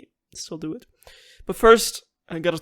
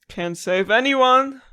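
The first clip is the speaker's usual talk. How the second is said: despair; 6 semitones higher, 8 dB louder, slower than usual. Real, not acted. Grieving after story loss.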